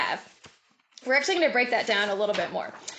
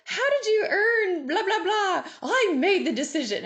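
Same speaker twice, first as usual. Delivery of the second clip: mock interview speech; 8 semitones higher than usual. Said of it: "Have you ever heard a speech?".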